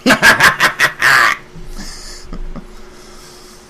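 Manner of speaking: unhinged villain cackle; maniacal laugh. Pretend. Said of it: "I'm practicing my maniacal laugh".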